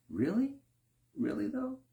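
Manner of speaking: very high, doubtful rising "Really, though?"; high incredulous rising question; skeptical challenge. Retold or imitated. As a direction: poke at the excuse, openly unconvinced. Mock skeptical reply.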